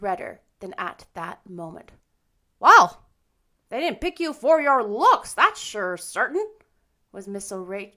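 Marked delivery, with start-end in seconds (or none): 2.6-6.4 loud, high emphatic comment. A narrator's reading. Rachel passes judgment on Anne's looks, emphatic. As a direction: pronounce it bluntly, loud and high. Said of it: "Missus Rachel Lynde's emphatic comment".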